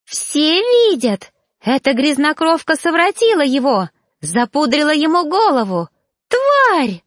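high, shrieking accusation ending in a scream; jealous woman shrieking at her rival. A narrator's reading. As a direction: shriek it at the whole room. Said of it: "верещала Сария, переходя на писк".

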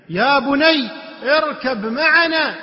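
loud, high, drawn-out call; a father's urgent call across a distance; calling out to son. Acted. Noah calling his son to board, calling out. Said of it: "ينادي ابنه".

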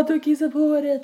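high, sing-song mocking jeer; mocking taunt. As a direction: taunt like a mean schoolgirl. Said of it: "Så det bara".

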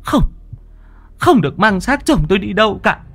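high, shrill-voiced deranged widow; crazed old woman. A narrator's reading. Mrs Xuân blocks the bed to keep her husband's corpse, high and frenzied. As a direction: refuse wildly in a high crazed voice. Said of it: "mà gằn giọng".

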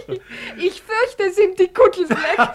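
high giggly laugh through the line; merry laughing speech. Acted. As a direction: giggle out the joke. Laughing about tripe.

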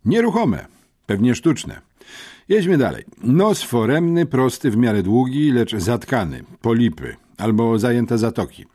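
lekko podniesiony głos rzeczowego chłopca; przejęty uczeń. A narrator's reading. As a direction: report it like an earnest schoolboy witness. (przejęty, rzeczowy uczeń)

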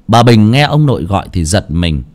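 ordinary delivery

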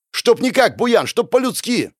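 very high, insistent cry; heated reproach. A narrator's reading. Hero reminds the elder of their pact, heated. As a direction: press the point, pitch way up. Quarrel over the old pact.

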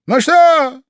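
very high, loud shrieked question; shouting queen. A narrator's reading. Queen checks on her soldiers' executions, shouting. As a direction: yell it imperiously at the troops. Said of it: "крикнула королева солдатам".

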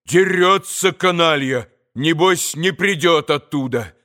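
plain reading voice

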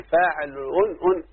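فاعل أن with the un ending repeated haltingly; unsayable double nun. Shown as a demonstration. Teacher proving to students it will not work, doubled ending. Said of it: "ما ينفعش أقول يا أولادي".

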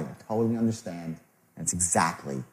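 low, hushed empathy; soft, knowing sympathy; understanding tonality. Shown as a demonstration. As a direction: drop pitch and volume, sincerely reassuring.

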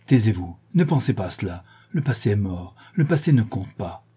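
plain reading voice

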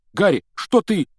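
panting, high and loud; out of breath. Acted. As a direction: gasp it out after running. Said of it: "задыхаясь от бега".

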